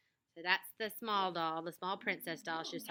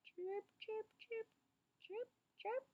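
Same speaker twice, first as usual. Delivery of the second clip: high, soft squeaky chirping; cricket chirps; 13 semitones higher, 10 dB quieter, slower than usual. Acted. Narrator mimics crickets in the room with high soft chirps.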